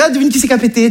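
high, teasing "Ah, devine" on the phone; mock cheerful prank call; playful phone greeting. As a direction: tease your girlfriend on the phone. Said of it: "je fais".

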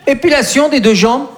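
ordinary talk, nothing marked

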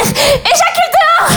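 high, drawn-out mock plea; desperate cry. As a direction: cry it out slowly, too late. Acts desperate cry during sex.

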